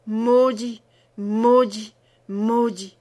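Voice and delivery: loud, falling cries of dark fury; cried curse. A narrator's reading. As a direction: hurl each repeated word like a mad curse. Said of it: "elle criait, et répétait".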